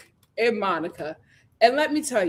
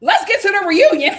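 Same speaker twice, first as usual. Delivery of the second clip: laughing announce; 6 semitones higher, 8 dB louder than usual. Real, not acted. Laughing first take.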